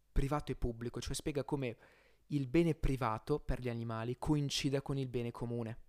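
usual speaking voice

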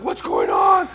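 loud, hammed-up panicked shout; screaming in panic. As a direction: yell out in confused alarm. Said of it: "everyone's screaming".

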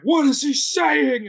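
high, stretched-out complaint; exaggerated bafflement, voice way up; mock bewildered. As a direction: imitate a baffled viewer, slow and high-pitched. Imitates baffled viewers complaining.

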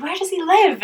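high, probing question; growing suspicion. Retold or imitated. Questioning friend about the guy, high alarmed probe. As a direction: press the question, pitch up in alarm. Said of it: "I was just like".

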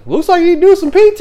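quick, high, loud imitation; mock dismissive put-down. Sergeant dismissing the civilian, mock put-down.

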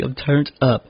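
normal talking voice

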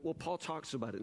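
ordinary talk

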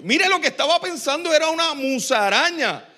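ordinary talk, nothing marked

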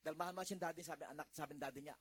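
ordinary delivery

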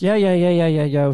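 breezy mechanic brushing it off; imitating the mechanic. Mechanic on speakerphone to the driver, breezy and loud.

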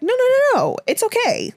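imitating an eager girl saying it's fine; high, hurried mock reassurance. Manny brushing off Craig's shock.

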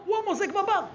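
high-pitched reveal, lightly astonished; surprised discovery. Acted. Storyteller revealing the brother pours drinks, surprised discovery. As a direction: deliver the finding high, as a statement.

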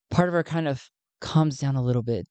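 usual speaking voice

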